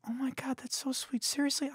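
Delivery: high, soft imitation of a touched woman; mock grateful woman. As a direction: play her overwhelmed by kindness, high and hushed. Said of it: "And she's like".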